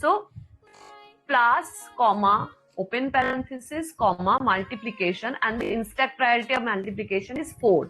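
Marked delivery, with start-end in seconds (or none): 1.3-5.3 dictating. Shown as a demonstration. Teacher dictates stack contents.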